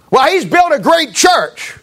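high, slow mock praise of a colleague; preacher mocking a pastor's admiring remark, high and drawn out; mimicked pastor. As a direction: raise the voice and stretch it out like a gushing pastor.

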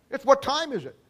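ordinary talk

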